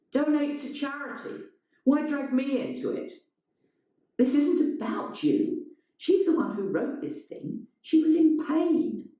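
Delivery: high, pleading argument; Elena's voice. A narrator's reading. Elena arguing.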